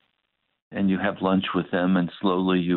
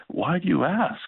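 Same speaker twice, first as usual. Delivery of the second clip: questioning; 8 semitones higher than usual. Retold or imitated. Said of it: "looked at me with a question".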